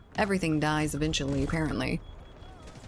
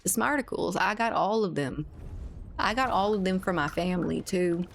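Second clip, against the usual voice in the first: lightly voiced Southern character boasting; 2 semitones higher than usual. Streamer doing a light Southern voice for the paladin character. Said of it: "So that's my southern voice".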